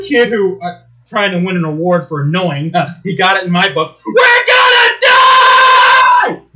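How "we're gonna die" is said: high, loud, very slow scared wail; mock scream. Imitating the kid on the ride, screaming.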